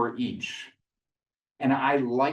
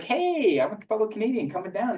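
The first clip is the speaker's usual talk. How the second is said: much higher, friendly upbeat hello; cheery greeting; 9 semitones higher than usual. Retold or imitated. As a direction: sell the friendly fellow Canadian. Greeting a fellow Canadian expat.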